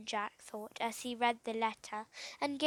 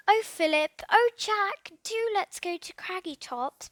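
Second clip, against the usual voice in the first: eager pleading, higher; begging; 6 semitones higher, 4 dB louder than usual. A narrator's reading. Girl begs the boys to go to Craggy Tops, pleading. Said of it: "begged Lucian".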